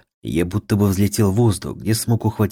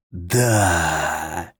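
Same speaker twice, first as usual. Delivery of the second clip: rasped monster voice; hoarse rasp; 3 semitones lower than usual. A narrator's reading. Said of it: "прохрипел монстр".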